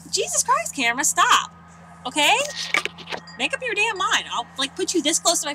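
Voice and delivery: fed-up vlogger scolding her zooming camera; yelling at the camera. Real, not acted. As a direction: snap at a stubborn camera. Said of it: "Yes, I'm yelling at the camera".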